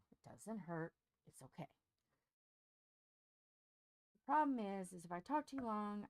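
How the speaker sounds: ordinary talk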